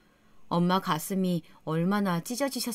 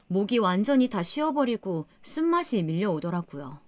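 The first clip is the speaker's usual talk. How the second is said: ordinary delivery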